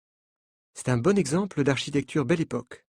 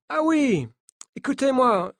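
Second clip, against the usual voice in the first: put-on higher French with a light American accent; 10 semitones higher than usual. Coach telling the journalists not to panic, in a light American accent. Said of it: "dit-il avec un accent américain".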